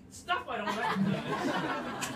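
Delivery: slow, breathy laugh through the words; laughing in disbelief at the food. Real, not acted. Host laughing to the team about strange food, laughing.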